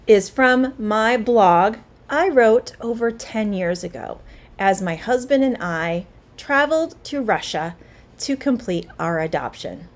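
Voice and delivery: ordinary delivery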